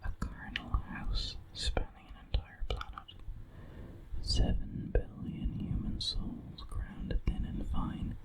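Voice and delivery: usual speaking voice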